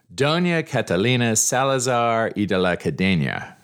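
Spanish name in an American accent; anglicized Spanish name, said carefully. Shown as a demonstration. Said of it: "sorry about the Spanish pronunciation there".

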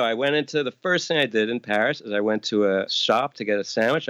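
ordinary delivery

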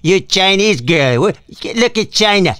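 hammed-up Trump taunt; mock Trump. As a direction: needle the reporter like Trump. Continues mocking Trump at reporters.

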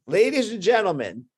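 a hint of showman's introduction; stage announcer. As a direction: announce it to a crowd, playfully. Acts the bandleader introducing on stage.

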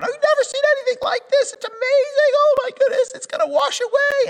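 very high, squeaky mock panic voice; hammed-up falsetto freak-out; panicked players. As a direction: squeal it like terrified onlookers.

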